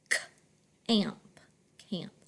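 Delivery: "camp" split into c and amp, then blended; quiet c, amp, then "camp"; sounded out. Shown as a demonstration. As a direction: decode "camp" softly in chunks.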